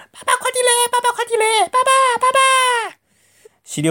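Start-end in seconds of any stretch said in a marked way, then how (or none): 0.1-2.4 put-on very high, frantic child voices; little cubs crying out. A narrator's reading. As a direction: squeal for daddy like tiny cubs. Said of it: "三只小物事辣里向穷叫不叫".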